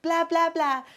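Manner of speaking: high, loud, silly babbling; silly baby talk. Retold or imitated. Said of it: "she'll say, like".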